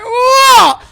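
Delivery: very high, loud mock holler; imitated bewildered yell. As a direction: yelp like a baffled bystander. Imitating confused man in crowd.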